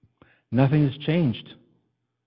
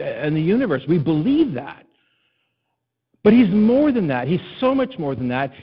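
raised, ringing pulpit voice, pressing each point home; preaching; 5 semitones higher, 4 dB louder than usual. Acted. Pastor exhorting his congregation, full preaching voice. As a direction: preach it out to the whole room, higher and louder.

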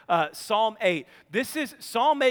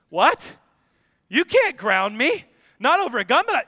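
put-on indignant kid; 3 semitones higher, 7 dB louder than usual. Son arguing with dad.

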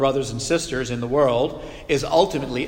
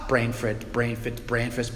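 garbled mutter; 4 semitones lower, 6 dB quieter than usual. Retold or imitated. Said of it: "they can't get the words out".

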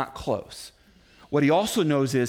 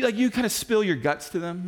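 ordinary talk

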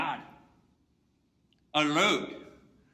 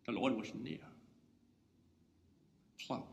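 normal talking voice

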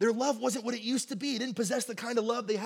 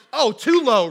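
loud, higher startled fix; alarmed correction; 5 semitones higher, 13 dB louder, slower than usual. Retold or imitated. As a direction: catch your own blunder out loud. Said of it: "So I'm like".

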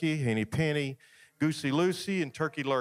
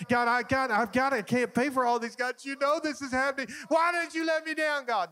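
high-pitched, hammed-up wailing at God; overacted frantic tantrum prayer; acted screaming complaint; 7 semitones higher than usual. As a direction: rant and whine to heaven in mock despair, building higher. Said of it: "you could run out screaming, yelling".

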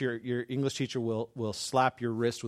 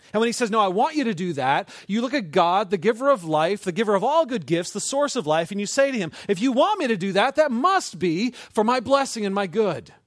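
loud, raised, driving delivery; impassioned preaching; 7 semitones higher, 7 dB louder than usual. Real, not acted. Preacher urging trust in the giver of life, impassioned. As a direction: build conviction phrase by phrase.

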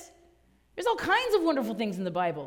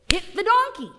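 ordinary talk

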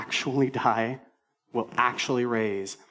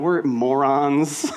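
normal talking voice

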